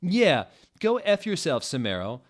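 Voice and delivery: high, loud mocking shout; loud jeer. A narrator's reading. Narrator joining the insult, loud jeer. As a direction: jeer it at him.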